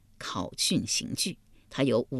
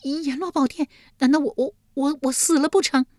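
much higher, stammering in terror; frightened disbelief; 10 semitones higher than usual. Acted. Yulian learns she is dead, high and terrified. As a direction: gasp out the question in fear. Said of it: "玉莲啊了一声道".

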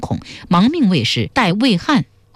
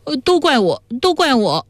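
high-pitched tearful self-blame; acted crying; 8 semitones higher than usual. A narrator's reading. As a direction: lament in a raised voice as a weeping captive. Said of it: "魏汉哭道".

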